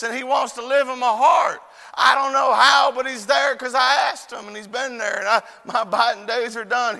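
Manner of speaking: emphatic evangelistic testimony; impassioned preaching. Real, not acted. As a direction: proclaim it with rising fervor to the congregation. Preaches at full force.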